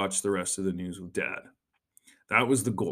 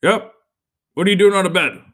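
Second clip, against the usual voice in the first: put-on loud, high stern dad catching the kids; 8 semitones higher, 11 dB louder, faster than usual. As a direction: boom it out like a dad disturbed at news time. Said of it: "And he'd be like".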